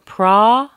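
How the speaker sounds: "pra" loud, high and held; long and strong. Shown as a demonstration. Teacher demonstrates the strong syllable, "pra" long and loud. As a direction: punch and stretch "pra". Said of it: "is very long and strong".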